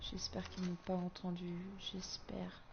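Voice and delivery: dropped to a near whisper; hushed. Real, not acted. Hiding from enemies just above.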